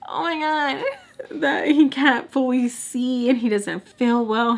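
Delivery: high voice squeezed by laughter; cracking up. Real, not acted. Telling why he can't see, real laughter in the voice. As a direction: struggle to get it out through giggles.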